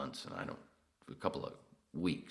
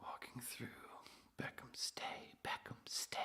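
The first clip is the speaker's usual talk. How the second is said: hushed unvoiced command; whispering; 10 semitones higher, 11 dB quieter than usual. Real, not acted. Telling his dog Beckham to stay, whispered.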